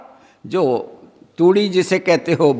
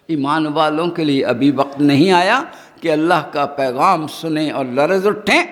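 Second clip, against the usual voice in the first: ordinary delivery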